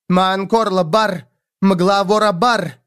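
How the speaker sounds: slow, high, emphatic command. A narrator's reading. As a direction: command insistently, stretching the words. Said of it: "повысила голос она".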